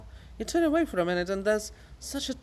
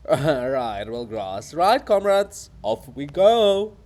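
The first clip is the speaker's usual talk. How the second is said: loud, rousing call to go; rally the comrades loudly; 8 dB louder, slower than usual. Acted. Chorus rallying off, shouting.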